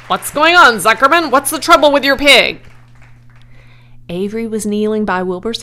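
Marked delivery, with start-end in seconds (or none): 0.1-2.6 high, loud announcer demanding answers; loudspeaker questioning. A narrator's reading. Loudspeaker asks about the fainted pig, loud insistent announcer. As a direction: boom questions across the fairground. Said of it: "asked the loudspeaker".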